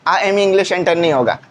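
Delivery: ordinary delivery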